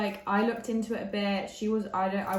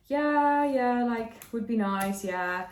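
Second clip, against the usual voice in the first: high, louder, drawn-out "yeah"; unconvinced agreement; 4 semitones higher, 6 dB louder, slower than usual. Retold or imitated. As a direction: humour them without really buying it. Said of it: "Like, she wasn't convinced".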